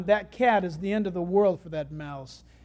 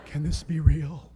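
slow, low awestruck voice; stunned sparrow; 7 semitones lower, slower than usual. Acted. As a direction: play a little bird frozen in disbelief.

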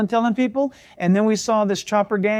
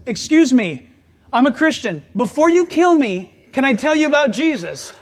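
raised, higher voice, bold but nervous; nervous loud plea; 6 semitones higher, 7 dB louder than usual. Retold or imitated. To the biker gang leader, a bold raised plea. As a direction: call out boldly to the scary biker.

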